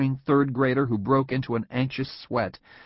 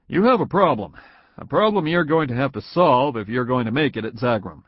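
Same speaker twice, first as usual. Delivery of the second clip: firm boss; 3 semitones higher, 6 dB louder than usual. A narrator's reading. Boss telling Tom he has a problem, firm and louder. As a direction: lay the problem on him firmly.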